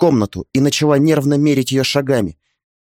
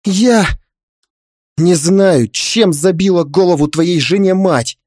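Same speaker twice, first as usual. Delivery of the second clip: higher-pitched indignant protest; changed-tone exclamation; 5 semitones higher, slower than usual. Acted. Father-in-law explaining himself to his son, indignant exclamation. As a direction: switch to an injured, raised voice. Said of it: "поменяв интонацию, воскликнул свёкор".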